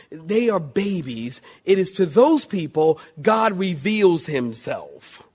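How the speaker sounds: ordinary talk, nothing marked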